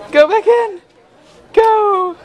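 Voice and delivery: high, loud urging; excited cheering. Real, not acted. Cheering on child at play.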